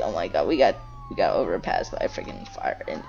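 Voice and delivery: ordinary delivery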